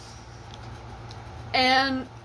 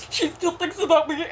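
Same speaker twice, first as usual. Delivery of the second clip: mocking repeat; 6 semitones higher than usual. Mocking ex again.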